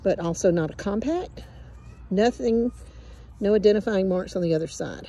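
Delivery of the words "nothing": ordinary delivery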